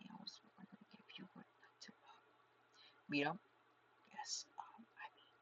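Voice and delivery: put-on shy girl whispering; timid whisper. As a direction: stammer it out in a soft whisper. Shy Hanako voiced by reader.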